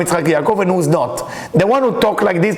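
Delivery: ordinary talk, nothing marked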